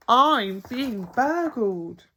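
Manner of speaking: slow, dragged-out shock; alarmed. A narrator's reading. Bill realizing a burglar is inside, shocked. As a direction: let the alarm sink in slowly.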